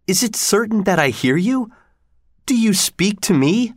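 high-pitched, overjoyed questions; joyful disbelief. A narrator's reading. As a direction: ask in joyful wonder, voice lifted high. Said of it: "cried out joyfully".

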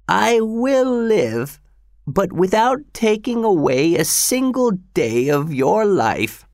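put-on high, slow dying old man; old father voice. A narrator's reading. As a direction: voice the frail father, rising.

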